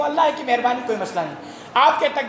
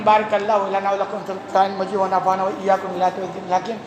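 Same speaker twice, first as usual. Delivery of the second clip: measured, lower chanted closing benediction in Arabic; Arabic prayer recitation; 5 semitones lower than usual. Acted. Preacher closes the sermon for his listeners, reciting the Arabic blessing. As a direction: intone the closing blessing like a liturgy.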